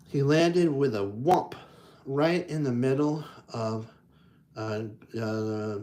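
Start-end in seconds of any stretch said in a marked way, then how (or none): none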